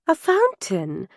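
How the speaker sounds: slow, drawn-out delight; delighted cry. A narrator's reading. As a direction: exclaim at a lovely discovery. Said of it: "Angelica cried".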